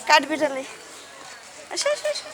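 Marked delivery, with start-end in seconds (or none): 1.7-2.3 high calling. Real, not acted. Calling child to come.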